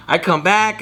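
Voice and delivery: usual speaking voice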